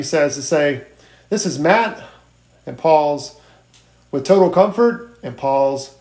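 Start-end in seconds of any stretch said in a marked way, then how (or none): 1.3-2.0 "This is Matt" ending on a rising tone; friendly uptone on "Matt". Shown as a demonstration. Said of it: "end on an uptone". 4.1-5.2 "with Total Comfort" lifted and ending up; pause, then a rising "Comfort"; friendly uptone close. Shown as a demonstration. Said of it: "end on an uptone".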